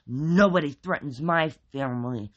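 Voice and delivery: loud, tight, teeth-clenched threat; loud clenched-teeth warning; through clenched teeth. A narrator's reading. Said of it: "he said through clenched teeth".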